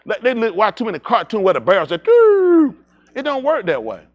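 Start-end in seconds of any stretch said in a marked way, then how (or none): 2.0-2.8 loud high mock gunshot sound; cartoon gun noise. Mocking cartoon guns, a loud playful bang. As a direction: blurt a cartoon barrel sound.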